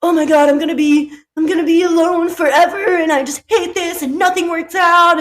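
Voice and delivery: imitating a friend's dating meltdown; mock insecure spiralling. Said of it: "go down a rabbit hole of, like, insecurities".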